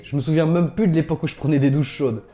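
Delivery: usual speaking voice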